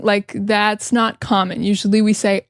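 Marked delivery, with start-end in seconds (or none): none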